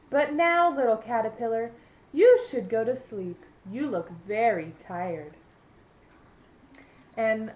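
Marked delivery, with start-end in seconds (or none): 0.1-5.4 gentle butterfly. Acted. Butterfly gently sends caterpillar to bed.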